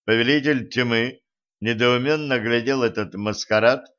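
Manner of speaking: plain reading voice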